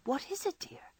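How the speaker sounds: a hushed, worried question; anxious inquiry. Acted. Joe at Beth's bedside at night, hushed and anxious. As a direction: ask softly so as not to wake anyone. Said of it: "with the anxious inquiry".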